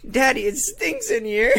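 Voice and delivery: put-on high-pitched kid complaining, laughter underneath; squeaky mock little-boy voice. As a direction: imitate a small boy through giggles. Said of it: "the little boy goes".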